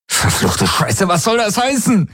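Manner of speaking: he yells at the helix in desperation; furious shout. A narrator's reading. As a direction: scream heißen with rage. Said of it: "schrie er sie an".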